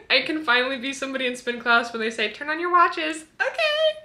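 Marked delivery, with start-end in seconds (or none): 2.3-3.2 lightly voiced spin instructor, high and loud; mock instructor call. Imitates spin class instructor calling out. 3.4-4.0 very high, loud squeal; mock-eager, squeaky and loud; eager reply. Acts out eager reply in class.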